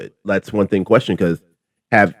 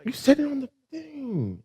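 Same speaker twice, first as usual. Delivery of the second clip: shocked; 12 dB quieter, slower than usual. Real, not acted. Shocked at slur on recording.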